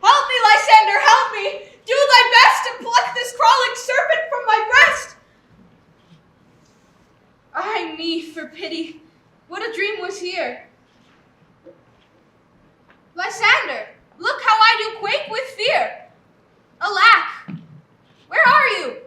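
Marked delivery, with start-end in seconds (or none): 0.0-5.2 loud, high panicked cry; waking in terror, shrieking for Lysander; terrified scream for help. Acted. Hermia wakes from nightmare. 13.1-19.0 high, loud frightened calls; fearful calling out. Acted. Hermia alone and frightened.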